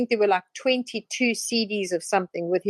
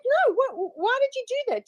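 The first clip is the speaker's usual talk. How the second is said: much higher mock woman's voice; mimicked woman. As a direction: squeak it up as the troubled wife. Woman reacting to water flick.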